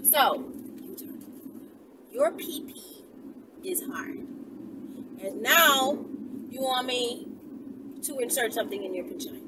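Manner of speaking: ordinary talk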